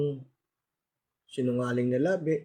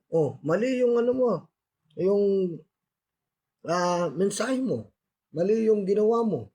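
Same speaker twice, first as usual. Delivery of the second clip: higher-pitched fault-finding; acted scolding, pitched up; mock criticism; 6 semitones higher, 3 dB louder than usual. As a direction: imitate a critic pointing out mistakes. Acts out further criticism.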